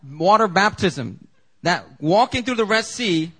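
high, loud, emphatic preaching. Acted. Preacher drives home the point to the congregation, loud and high.

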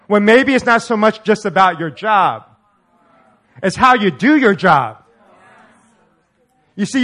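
loud, rising, emphatic preaching; preaching at full force. Acted. Preacher urging the congregation to keep their eyes open, full-force preaching. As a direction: drive it home to the crowd.

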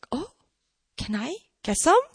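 hesitant, tentatively curious ask; interested. Retold or imitated. Skeptical woman now asking Jesus for water, interested. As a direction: pause after the oh, ask with a rise. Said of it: "she shows interest".

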